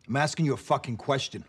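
angry shout. Acted. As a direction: bark the question at him. Cop demands an answer.

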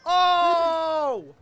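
high, loud, stretched-out yell of protest; indignant shout at the blue turtle; outraged shout. Real, not acted. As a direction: yell at the game for the unfair hit. Hit by a shell in race.

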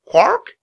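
high, loud squawk; raven's croak. A narrator's reading. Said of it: "he croaked".

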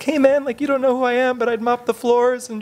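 high, eager, pleading voice; mock eager email voice. Reading his own eager email to a bar owner, high and pleading. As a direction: pitch it way up like a nervous fan. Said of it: "writing an email to Sean Hord".

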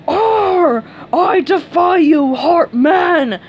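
loud, high roar of defiance; raging cartoon scream. A narrator's reading. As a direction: roar the challenge at full force. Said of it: "Patrick screamed".

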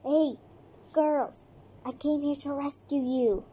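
ordinary talk, nothing marked

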